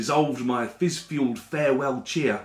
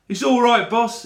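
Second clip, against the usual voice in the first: higher, louder, kindly seller's reassurance; kindly street seller; 9 semitones higher, 5 dB louder than usual. Acted. Seller forgiving the narrator's lie, kindly higher voice. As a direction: reassure him like a friendly vendor.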